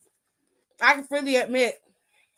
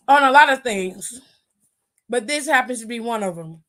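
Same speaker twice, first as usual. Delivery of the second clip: usual speaking voice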